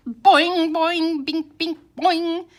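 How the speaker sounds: high springy boings; boing sounds. A narrator's reading. Narrator voicing the drying hair springing up in high boings. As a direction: bounce each boing out.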